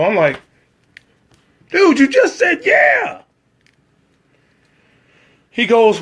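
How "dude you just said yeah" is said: high, loud, slow exasperated shout. Retold or imitated. Telling the assistant director he just said yeah, exasperated shout. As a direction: throw it back at him in disbelief.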